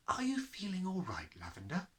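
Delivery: a kind teacher asking with concern; Miss Honey. Acted. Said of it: "Miss Honey asked".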